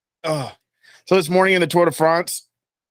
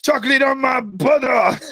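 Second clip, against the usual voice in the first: high mock-shouted comic line; imitated singer; 6 semitones higher than usual. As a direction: mimic the performer with a pitched-up holler. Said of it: "He's like".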